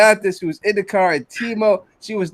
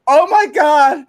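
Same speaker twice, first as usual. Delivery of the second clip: high, loud gushing fangirl; gushing fan; 8 semitones higher, 3 dB louder than usual. Retold or imitated. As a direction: squeal like a starstruck fan. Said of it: "she goes".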